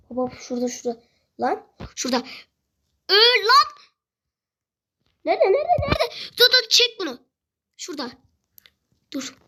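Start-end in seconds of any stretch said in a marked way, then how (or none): 3.1-7.2 loud, high, urgent gamer cries; frantic shouting. Real, not acted. Gamer hunting an enemy in a firefight, frantic.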